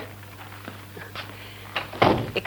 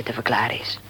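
whisper; 9 semitones lower, 6 dB quieter than usual. Acted. Worried mother mentions sick daughter.

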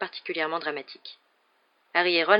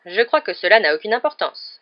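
louder, firm, cold dismissal; icy tone; 4 semitones higher, 7 dB louder than usual. A narrator's reading. Professor dismissing the seer's alarm, icy. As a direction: dismiss it coldly, with force. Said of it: "d'un ton glacial".

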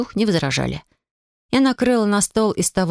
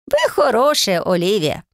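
a touch of shy gratitude, starting high; bashful fox; 3 semitones higher, slower than usual. A narrator's reading. Stove spirit thanking Olivia, shy and touched. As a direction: thank her shyly. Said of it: "проговорил смущённый лис".

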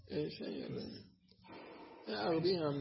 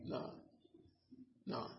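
usual speaking voice

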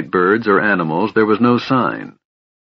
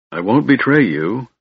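plain reading voice

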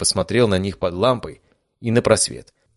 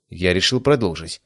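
ordinary delivery

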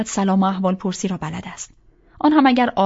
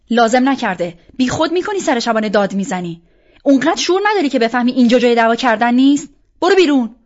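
loud and fast, rising to a louder, higher yell at the end; furious shouting; 3 semitones higher, 8 dB louder than usual. Acted. Roja yells at Arsalan for shouting at Shabaneh, furious shout. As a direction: throw him out of the room at full volume.

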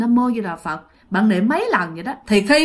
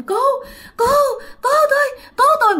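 screaming for help; much faster than usual. Retold or imitated. Dreamer shouting for help while fleeing the ghost, high panicked cry. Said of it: "các bạn la làng lên".

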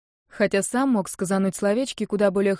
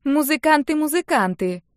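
higher, sing-back of her excuse; mocking echo; 7 semitones higher, 4 dB louder than usual. A narrator's reading. Little sister parrots the excuse, mocking. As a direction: echo the word twice as if humouring her. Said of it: "покивала сестрёнка".

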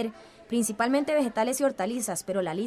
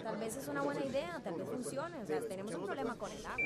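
much softer, receding voice; fading into distance; 17 dB quieter than usual. Acted. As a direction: let it drift far off. Meeting fades as scene cuts. (voz que se aleja, mucho más suave)

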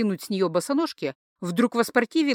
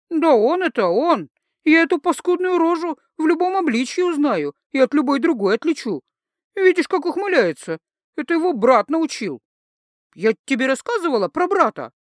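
higher and louder, slurring tipsy woman; tipsy rant; 6 semitones higher, 4 dB louder than usual. Acted. Tipsy Etain rants to friends, high and loud. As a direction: rant on, a bit drunk and worked up.

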